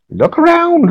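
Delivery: very high crone call; very old woman. Acted. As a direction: sing it out like a hag. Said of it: "very old woman".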